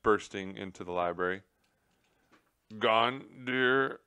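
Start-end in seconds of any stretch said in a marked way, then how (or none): none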